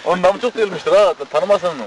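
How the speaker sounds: usual speaking voice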